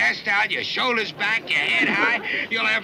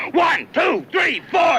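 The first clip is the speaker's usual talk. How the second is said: shouted countdown; 8 semitones higher, 4 dB louder, slower than usual. Real, not acted. I'm gonna count to ten, and you better be gone.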